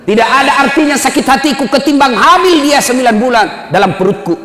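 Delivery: the mother pleads harder for her son. Acted. Mother's plea, raised take.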